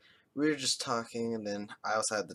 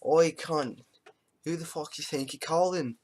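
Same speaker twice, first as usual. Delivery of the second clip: a lightly done rough Australian greeting; a touch of an aggressive Australian bloke; Australian accent; 5 semitones higher than usual. Retold or imitated. Retelling the call to friends in a mild mock Australian accent. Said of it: "I answered the phone like this".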